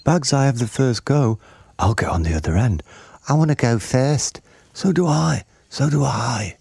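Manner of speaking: put-on high-pitched eager children; niños impacientes. A narrator's reading. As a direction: pipe up like children claiming turns. Eager children claim turns.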